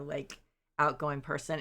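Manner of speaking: ordinary delivery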